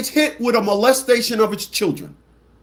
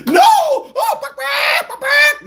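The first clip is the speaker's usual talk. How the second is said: very high, loud squealing babble; shrieking mock babble; 4 dB louder, much slower than usual. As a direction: squeal like a panicked fool. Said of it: "y'all be like".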